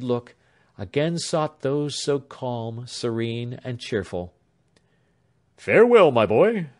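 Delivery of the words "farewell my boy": put-on loud, high farewell from an old doctor; hearty farewell. A narrator's reading. Doctor bidding Alfred farewell, loud. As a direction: send the boy off heartily. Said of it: "said the doctor".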